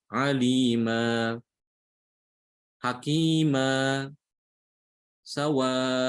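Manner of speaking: alima, hakima, sawa'a said very slowly, each final a held for two beats; measured, deliberate drill; drilled examples. Shown as a demonstration. Teacher chants the words at two beats each. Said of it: "harus dibaca dua harokat".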